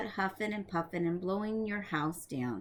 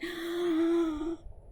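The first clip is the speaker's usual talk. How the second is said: higher, sharp gasping intake before a sneeze; sneeze build-up gasp; 7 semitones higher than usual. Acted. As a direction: catch the breath as the sneeze comes. Wolf about to sneeze.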